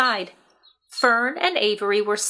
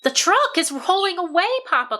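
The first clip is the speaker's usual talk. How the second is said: cried out; 8 semitones higher than usual. A narrator's reading. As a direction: warn Papa urgently. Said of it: "cried Fern".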